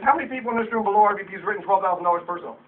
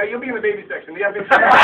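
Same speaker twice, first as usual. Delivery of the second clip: ordinary talk, nothing marked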